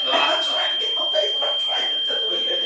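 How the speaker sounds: high, loud frantic rush; loud, high-pitched frantic retelling. Retold or imitated. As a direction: tumble the words out in a panic. Frantic retelling of deleting post.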